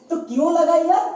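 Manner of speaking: ordinary delivery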